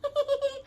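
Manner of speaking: high light giggle; girlish giggle. A narrator's reading.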